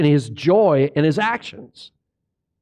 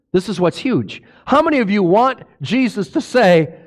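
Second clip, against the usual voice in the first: normal talking voice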